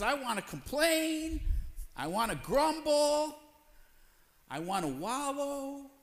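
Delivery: high, slow whining about wanting to complain; whiny flesh. Retold or imitated. Preacher voices the flesh whining to the congregation, high and slow. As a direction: whinge like a sulking child, drawn out. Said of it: "the flesh which says".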